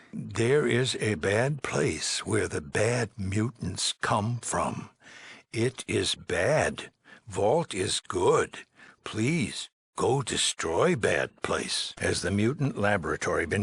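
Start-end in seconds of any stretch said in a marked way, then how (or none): 0.1-11.9 very slow, higher, each word set apart; talking down. Acted. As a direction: spell it out simply as if to a simpleton. Simplified talk to a slow listener.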